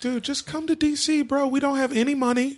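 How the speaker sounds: high-pitched mock plea to a free agent; pleading recruiter. Broke team pitching a star to come to D.C., high mock plea.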